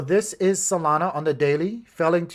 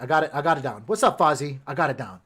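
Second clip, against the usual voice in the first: ordinary talk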